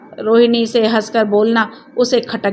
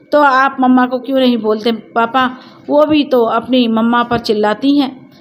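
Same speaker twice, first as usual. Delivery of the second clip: ordinary delivery, nothing marked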